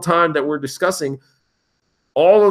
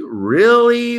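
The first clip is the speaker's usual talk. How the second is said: "Really?" pitched much higher and louder; incredulous; 10 semitones higher, 5 dB louder than usual. Retold or imitated. Reacting to the crash headline, higher and louder in disbelief. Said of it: "I was like".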